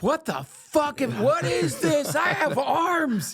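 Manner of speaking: high, drawn-out comic wail; slow, high shout of exaggerated astonishment; mock bewilderment. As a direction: cry out amazed at your own body, hammed up. Acting out bewildered people, hammed up.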